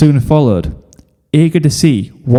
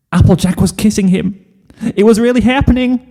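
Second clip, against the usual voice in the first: excited narration; 10 semitones higher than usual. A narrator's reading.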